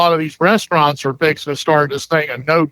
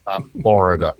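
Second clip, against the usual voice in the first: put-on low drawled old Florida accent; old rural Florida drawl; 7 semitones lower than usual. Shown as a demonstration. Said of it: "that Floridian accent you got".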